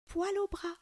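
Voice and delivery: a high, soft cheeky murmur; a tiny high-pitched mischievous murmur. Acted. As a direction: slip the joke in from inside the belly. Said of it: "murmure alors Zékéyé".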